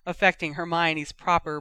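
ordinary delivery

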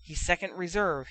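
plain reading voice

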